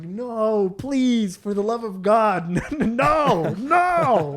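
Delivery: high, drawn-out mock begging; angry pleading. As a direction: wail in protest, hammed up. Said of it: "We're, like, so angry".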